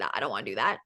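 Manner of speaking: low, soft mock reluctance; a lightly voiced grumbling refusal; reluctant groan. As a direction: sink the voice like you can't be bothered. Retold reluctant reaction to workout.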